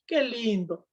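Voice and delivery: high, sing-song pity for a crying sister, with a teary catch; mock consoling. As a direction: coo over her like a weeping child, half mocking. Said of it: "¿Qué hacemos nosotros cuando comienza a llorar?".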